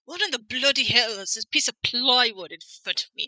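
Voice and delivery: hammed-up British accent, rising to a loud outraged end; mock posh British. Imitating Edgeworth in a British accent, scoffing at plywood. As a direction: play a stuffy Brit appalled at the plywood. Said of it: "it'd be more like".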